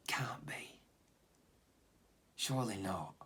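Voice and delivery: disbelieving whisper. Acted. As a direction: breathe it in disbelief. Disbelieving aside to self.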